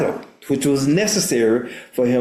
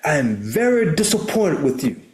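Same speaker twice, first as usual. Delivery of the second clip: disappointed father scolding; 10 semitones higher than usual. Retold or imitated. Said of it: "Mufasa looks down on him and says".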